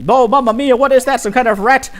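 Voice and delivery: high, loud alarmed yelp; startled shriek. Acted. Heroine spotting the robot, shrieking high in alarm. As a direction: jump back in fright at the thing.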